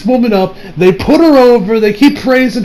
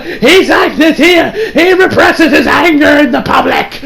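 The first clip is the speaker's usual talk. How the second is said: higher, slow voice through laughter; embarrassed Champa; 8 semitones higher, slower than usual. Retold or imitated. Champa excusing his Kai's outburst, high and slow. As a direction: explain away your Kai with a laughing excuse.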